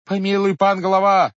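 high, loud begging; pleading cry. A narrator's reading. Villagers bowing to the head, crying for mercy. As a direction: beg for mercy. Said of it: "закричали некоторые".

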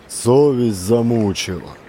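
ordinary delivery

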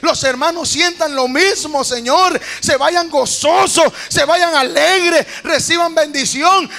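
high, loud pleading prayer to God; preacher reliving his tearful prayer aloud; loud fervent prayer. Retold or imitated. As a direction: cry out to the Lord, slowing on the joyful words. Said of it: "Yo le digo".

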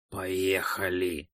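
slow, soft, hissing demand. Acted. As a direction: hiss it like a snake woman. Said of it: "шипящим голосом требует девушка".

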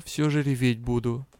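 plain reading voice

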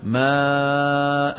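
ماء recited extremely slowly with a long drawn aa; very slow pause form. Shown as a demonstration. Teacher demonstrating the long pause form for the class. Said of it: "شو يقف عليها؟".

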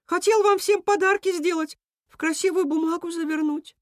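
high-pitched character voice; story character explaining. A narrator's reading. Storyteller voicing a character explaining gifts, high-pitched.

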